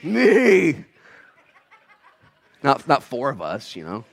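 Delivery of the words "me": loud, high call with a big held last word; rallying call. Shown as a demonstration. Preacher leading the congregation in the response, rallying call. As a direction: lead the whole room in shouting it out. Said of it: "would be better if all of us said it".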